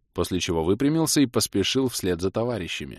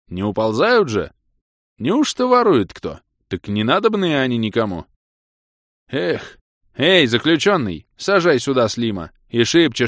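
louder grumbling, then barking orders; bossy guard; 5 semitones higher, 7 dB louder than usual. A narrator's reading. Guard searching bins then urging prisoner on, loud and bossy. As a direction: hurry the prisoner along.

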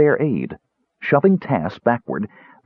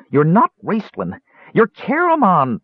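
rising, high, pleading cry; desperate cry; 9 semitones higher than usual. A narrator's reading. Tass desperately pleads with a confused Caramon in a high, pleading cry. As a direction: beg him to snap out of it. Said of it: "Tass cried in desperation".

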